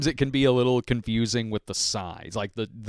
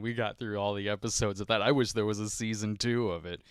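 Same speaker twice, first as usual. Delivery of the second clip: ordinary delivery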